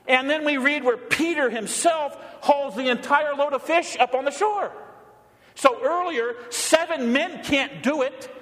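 fired-up preacher shouting the point; excited preaching. Real, not acted. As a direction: proclaim the miracle with mounting wonder. Preacher builds to a climax.

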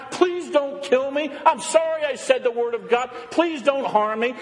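high, pleading mock cowardice; mock crying plea. Imagined Jeremiah begging the priests for his life, mock crying plea. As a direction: beg as a hammed-up coward. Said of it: "Does he cry".

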